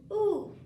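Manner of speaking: high, loud stretching ooh; lightly voiced yawn. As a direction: give a big stretchy yawn sound. Iguana stretching, yawn sound.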